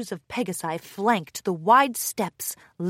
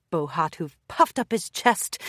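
ordinary delivery, nothing marked

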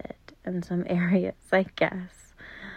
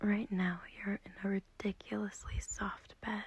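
hushed whisper, much softer; 5 dB quieter than usual. Acted. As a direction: whisper so the sleeper beside you doesn't wake. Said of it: "I'm whispering because I don't want to wake up".

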